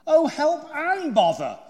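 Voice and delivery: loud, high, slow cry of frustration; desperate wail. A narrator's reading. Pooh stuck fast in Rabbit's hole, desperate drawn-out wail. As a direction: draw out a storybook cry for help.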